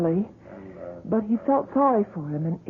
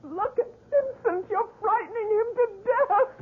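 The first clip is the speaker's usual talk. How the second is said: weep and beg him to stop; crying plea; 9 semitones higher than usual. Acted. Wife pleads, crying.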